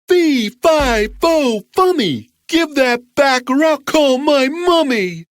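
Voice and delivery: put-on high, childish giant bellow; roaring giant. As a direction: roar it as an indignant giant kid. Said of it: "roared the giant".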